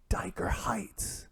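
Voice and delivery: slow hushed whisper. Retold or imitated. Gym guy naming the neighborhood, whispered. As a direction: breathe the name out slowly. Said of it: "whispering to each other".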